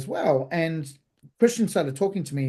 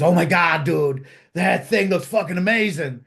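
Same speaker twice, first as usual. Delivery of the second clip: put-on loud, brash American accent; annoying American; 4 dB louder than usual. An American stranger gushing over the platter, annoying American accent. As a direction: put on an irritating American guy. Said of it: "this annoying American walks up behind me and says".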